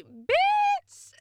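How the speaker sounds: very high, loud mock shriek; shouted diss. Mocking the ex who came back late, shrieked. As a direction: screech the insult.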